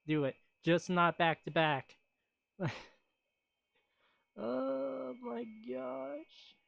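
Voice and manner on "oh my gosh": high, slow, soft oh my gosh; long weary oh my gosh; drawn-out sigh. Real, not acted. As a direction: exhale the memory slowly. Sigh after sad anime memory.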